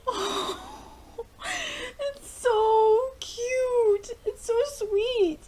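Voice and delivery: very high, drawn-out coo; adoring gush. Real, not acted. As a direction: melt slowly over something sweet. Gushing over the couple.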